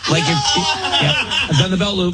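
high laughing admission. Real, not acted. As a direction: laugh through the confession.

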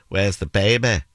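put-on Manchester accent, baby ending in an open "ba"; put-on Manchester vowels; Mancunian accent. Shown as a demonstration. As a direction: ask it like a Mancunian, falling at the end. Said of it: "Manchester, you'd say".

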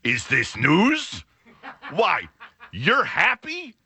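slow, smirking disbelief, rising high at the end; a son teasing his dad with mock surprise. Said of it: "Luke smirked and he goes".

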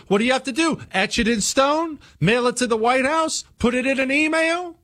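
climbing disbelief, pitch going up; incredulous. Real, not acted. Host mocking threat rules, rising incredulity. Sarcastic rhetorical questions.

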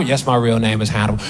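tired of repeating it; flatly resigned reply; weary reply. Retold or imitated. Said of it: "same conversation".

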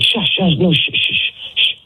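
high, hissed shushes; imitated shushing. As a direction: imitate her urgently hushing you. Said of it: "She's telling me to, to shush".

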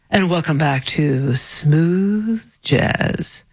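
very slow, drawn-out smooth jazz DJ; sultry radio host. Acted. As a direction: play the sexy late-night jazz announcer. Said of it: "That's a sexy voice".

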